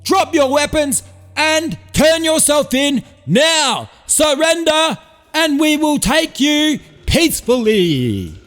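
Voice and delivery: high, slow, shouted through a megaphone. Acted. Sergeant orders Brody to surrender over a megaphone. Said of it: "the sergeant jumped on the megaphone".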